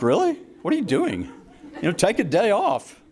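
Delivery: high-pitched incredulous retort, retold for laughs; exasperated disbelief. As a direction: play up comic disbelief, pitch jumping up. Retelling own disbelief at mother.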